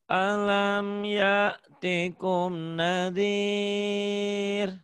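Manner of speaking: teacher models the verse in slow recitation; Quran recitation. Shown as a demonstration. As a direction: tajwid chant, drawn out.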